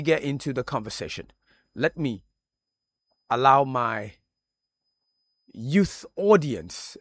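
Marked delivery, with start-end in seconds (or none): 5.5-6.0 youth drawn out slowly and punched; stress on youth. Real, not acted. Host introducing his young audience on air, stress on youth. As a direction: lean hard on the word youth, stretching it. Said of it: "I'm emphasizing the word youth".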